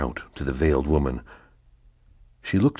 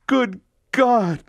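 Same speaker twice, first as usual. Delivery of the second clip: much higher, louder cry of shock; 6 dB louder than usual. Acted. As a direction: reel from the news. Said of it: "cried Mercedes, reeling".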